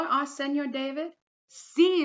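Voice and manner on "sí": high, loud shout; children shouting. Acted. Children answer the teacher with a high, loud shout. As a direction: shout it out together. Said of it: "the children shouted".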